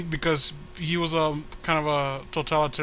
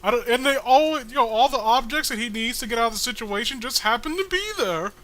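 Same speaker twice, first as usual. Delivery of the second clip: high comic character voice; 7 semitones higher than usual. Acted. Reading comic character's lines aloud.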